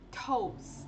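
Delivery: "toast" with a breathy plosive T at the start; aspirated T. Shown as a demonstration. Teacher demonstrating the aspirated T in toast. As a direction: push air out on the T of "toast".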